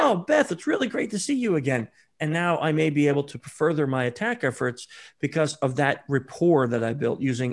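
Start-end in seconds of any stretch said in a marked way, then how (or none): 0.0-1.9 higher, eager fake-coincidence chatter, rushing the recall; feigned surprise. Shown as a demonstration. Social engineer faking a chance meeting at Starbucks, bright and eager. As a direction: act delighted by a lucky run-in.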